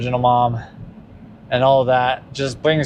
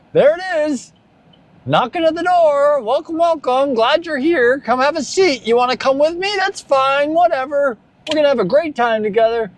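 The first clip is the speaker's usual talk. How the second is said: mock welcoming host; 13 semitones higher than usual. Greeting sadness as a guest.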